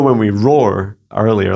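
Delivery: ordinary delivery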